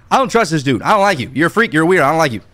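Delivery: ordinary talk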